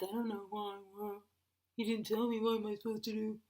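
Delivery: dopey husband impression; mock husband voice. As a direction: imitate a clueless husband's mumbly whine. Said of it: "We all have that same husband voice".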